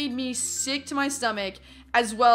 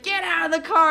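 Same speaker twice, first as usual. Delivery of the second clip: raised, loud mock shout; retold angry order; 5 semitones higher, 5 dB louder than usual. Angry boyfriend blocking the truck, retold shouted order. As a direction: shout it as an acted demand.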